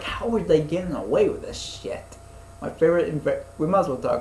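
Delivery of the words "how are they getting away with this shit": mock-incredulous, pitched higher; retold disbelief, voice lifting. Asking how the show got away with it, incredulous. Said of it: "I was like".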